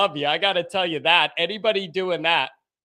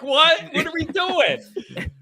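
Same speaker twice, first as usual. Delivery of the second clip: higher-pitched baffled retort; retold bewilderment; 8 semitones higher, slower than usual. Host retells his reaction to the co-host's connection mix-up, baffled. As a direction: replay your confusion, pitched up. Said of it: "I'm like".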